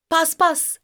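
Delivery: high, quick, eager answer; joint exclamation. A narrator's reading. Two children saying the answer at once, eagerly. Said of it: "dedi ikisi birden".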